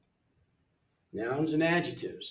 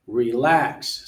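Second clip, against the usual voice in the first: reLAX, second syllable louder; second-syllable stress; 6 dB louder than usual. Shown as a demonstration. Teacher sorts words by stress, second syllable of Relax stressed. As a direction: punch the end syllable of Relax. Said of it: "second syllable".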